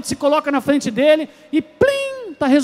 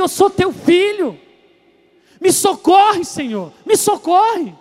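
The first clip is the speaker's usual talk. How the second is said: higher, slow heartfelt plea to God; prayer plea; 6 semitones higher, slower than usual. Shown as a demonstration. Believer crying out to God for rescue, earnest. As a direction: pray it as a child to a father. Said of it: "Se coloque diante do teu Deus e diga".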